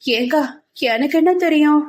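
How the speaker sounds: high, plaintive little-sister protest; helpless younger sister. Acted. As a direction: whine helplessly to big sister.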